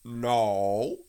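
drawn-out put-on no; disguised rabbit voice. A narrator's reading. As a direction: deny it in a different voice. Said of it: "in a different sort of voice this time".

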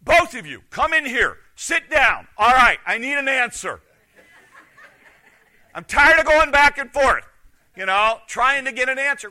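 loud, exasperated demand from a fed-up son; frustrated teen calling his parents in. Retold or imitated. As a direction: hammer it out, fed up with the runaround. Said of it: "Josh got so frustrated".

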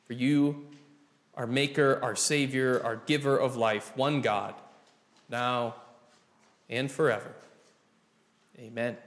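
measured pastoral prayer with long pauses; public prayer. Real, not acted. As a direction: pray aloud for a congregation, phrase by phrase. Leads congregation in prayer.

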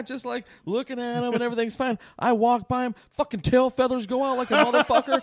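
ordinary talk, nothing marked

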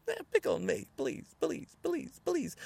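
high-pitched taunting beg; mock pleading. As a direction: goad them by begging for a fight. Dares them with mock begging.

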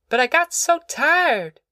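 put-on high, slow complaining boy; whiny boy. A narrator's reading. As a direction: drag it out like a tired kid. Child complains to Ma.

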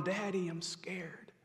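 small voice calling for Daddy; frightened child's hush. Retold or imitated. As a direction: drop to a quiet, scared child. Scared child's plea repeated.

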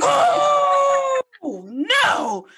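high drawn-out mock cry of oh no; alarmed realization. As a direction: wail it high as it dawns on you. Acts out sudden alarmed realization.

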